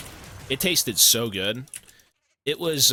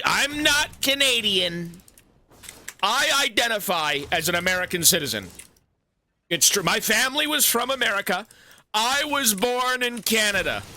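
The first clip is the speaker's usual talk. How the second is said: mock American patriot; 9 semitones higher than usual. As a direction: shout it like an over-the-top flag-waving American.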